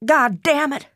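high, sharp furious outburst; furious curse. A narrator's reading. Mother curses her late daughter at the door, high and furious. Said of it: "She said".

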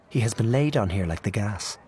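ordinary delivery

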